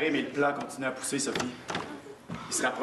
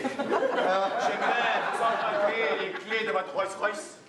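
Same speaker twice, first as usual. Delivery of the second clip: higher, very slow with laughter; slow laughing plea; 8 semitones higher, much slower than usual. Acted. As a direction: plead slowly through giggles. Son asks parents, laughing.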